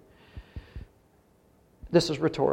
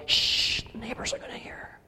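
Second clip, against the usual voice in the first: wife's whisper; 5 semitones higher, 8 dB quieter than usual. Retold or imitated. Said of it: "the wife saying to the husband".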